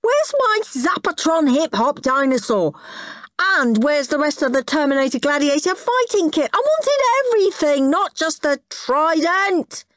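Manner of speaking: put-on high-pitched childish protest; indignant whining. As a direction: complain like a greedy, cheated boy. Boy protests about missing presents.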